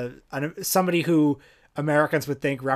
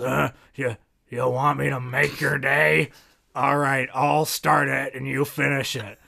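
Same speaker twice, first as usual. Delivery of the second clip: slow, halting movie-star impression; imitated trailing-off old actor; slower than usual. Retelling the actor answering the audience at the convention, slow halting impression. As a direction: play the star groping for his words. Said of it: "And he goes".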